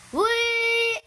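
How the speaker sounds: high gleeful whee; excited whee. Real, not acted. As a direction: squeal with delight on the drop. Jumping into the game.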